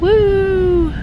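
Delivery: loud, high whoop of delight; sudden gleeful cry; excited whoop. Real, not acted. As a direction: cheer the boat like a fan. Cheering the boat on.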